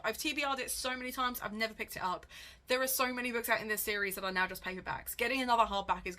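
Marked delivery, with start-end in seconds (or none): none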